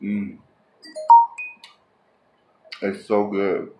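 talking while chewing food; savoring a bite, muffled; mouth full, chewing. Real, not acted. Seen 3: Bites sausage at -3; jaw still working during the labelled "mm.

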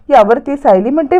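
ordinary delivery, nothing marked

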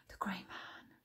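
awed, breath-only whisper; murmured. A narrator's reading. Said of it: "Flint murmured".

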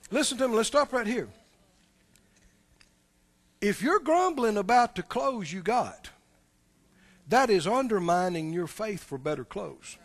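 ordinary talk

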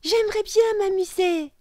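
put-on very high, loud child voice; high-pitched child's wish; eager little girl. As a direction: pipe up as a keen small girl. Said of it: "répondit Lola".

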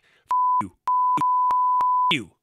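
high squeaky falsetto imitation of a cursing cheerleader; imitating an enraged cheerleader in a high squeaky put-on voice; mock cheerleader tirade. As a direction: squeal the curses out fast in a mocking falsetto. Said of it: "and then she was like".

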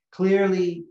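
usual speaking voice

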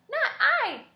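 squeaky mouse. Acted. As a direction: squeak out a lazy no. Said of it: "said the mouse".

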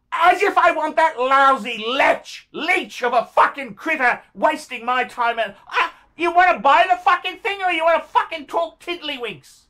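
really fed up, hurling abuse at someone absent; angry tirade. Real, not acted. Seller fuming at a buyer wasting his time, angry tirade.

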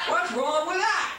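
high, drawn-out mocking whine. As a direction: whine the catchphrase up high and slow. Repeated mocking catchphrase.

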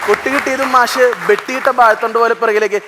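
ordinary delivery

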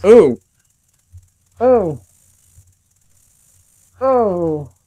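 high, loud yelps of real shock; repeated stunned yelps. Real, not acted. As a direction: gasp it out as if your heart stopped. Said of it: "I think I just had a miniature heart attack".

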